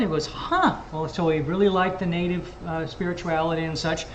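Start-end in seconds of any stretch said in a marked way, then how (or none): 0.3-0.7 high-pitched musing "huh"; retold intrigued reaction. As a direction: imitate someone's curiosity being piqued.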